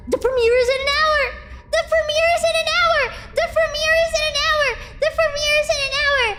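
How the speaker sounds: rapid, high-pitched excited squealing; breathless-fast giddy announcement; real excitement. Real, not acted. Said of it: "I'm so excited!".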